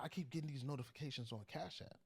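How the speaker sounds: imitating worried mom. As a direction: raise the pitch for mom's complaint. Son retells mom's early call.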